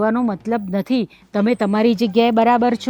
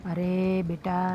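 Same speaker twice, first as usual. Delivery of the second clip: soft, pleading call of the name; calm her down gently; mother-in-law softly placating; soothing; 5 semitones lower, 8 dB quieter, much slower than usual. Acted. Elder soothes angry daughter-in-law.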